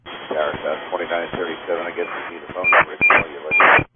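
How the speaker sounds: crackly radio transmission; radio static bursts. Real, not acted. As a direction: pilot heard over the radio. Pilot radio call.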